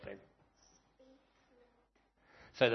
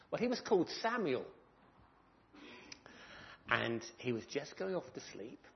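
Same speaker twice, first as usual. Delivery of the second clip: usual speaking voice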